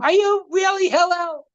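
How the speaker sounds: high, shouted doubting question; mock shout. Joker doubting Hillel, high mock shout. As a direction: yell it in disbelief.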